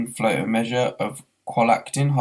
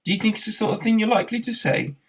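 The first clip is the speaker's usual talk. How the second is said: much higher, fast, wry; Arthur's high, quick retort; Arthur quipping; 11 semitones higher, faster than usual. Acted. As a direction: toss it back with wry mockery. Arthur mildly teasing.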